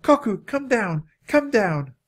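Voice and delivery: high-pitched coaxing call; high, falling calls. A narrator's reading. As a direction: call up to someone atop a cliff. Annie calls up the cliff.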